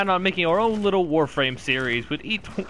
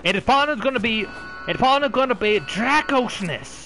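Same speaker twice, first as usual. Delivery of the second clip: put-on high comic Australian-Southern accent; 7 semitones higher than usual. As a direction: put on a muddled Australian-Southern twang, higher than usual. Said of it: "trying to do an Australian or a Southern accent".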